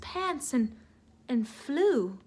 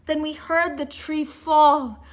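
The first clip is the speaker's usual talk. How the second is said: ordinary delivery, nothing marked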